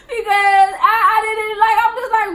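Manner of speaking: high, loud, stammering shock; flustered shock. Retold or imitated. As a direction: sputter in high alarm.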